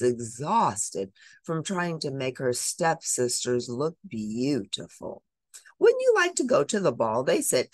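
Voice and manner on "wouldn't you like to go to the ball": high, loud, fast and mocking; cruel teasing. Stepsisters taunting Cinderella about the ball, high mocking tease. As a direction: dangle the ball in front of her to hurt. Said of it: "teasing her cruelly".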